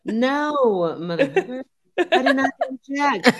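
high-pitched comic outrage; mock indignant refusal. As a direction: turn the customer down, half laughing. Acted sex worker refusing a check.

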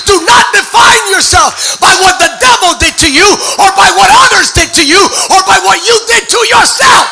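loud, ringing sermon climax; a preacher roaring over the organ; full-force preaching. Acted. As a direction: preach it to the rafters.

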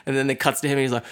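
ordinary delivery

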